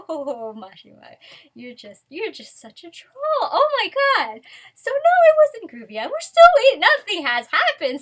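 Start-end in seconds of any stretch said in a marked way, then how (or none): 3.4-8.0 louder and much higher, exasperated cry; frustrated outburst. Real, not acted. A fan tells viewers they're still waiting, in an exasperated loud outburst. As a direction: let the fan frustration burst out.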